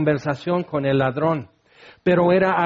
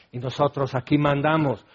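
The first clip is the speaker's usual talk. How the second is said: ordinary talk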